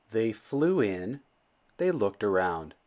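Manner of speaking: plain reading voice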